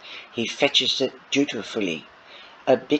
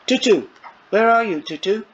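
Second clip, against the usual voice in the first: high, loud call for the dog; 9 semitones higher, 5 dB louder, faster than usual. Acted. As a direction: call out across the house. Calling the dog through house.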